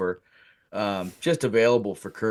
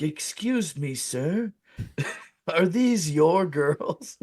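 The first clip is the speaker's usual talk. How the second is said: higher, timid-sounding nerd impression; nerdy guy; 4 semitones higher, much slower than usual. Two nerdy guys approach the supposed pimp, mock-nerdy asking. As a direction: imitate a polite nerd asking, drawn out at the end. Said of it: "two nerdy guys come walking over".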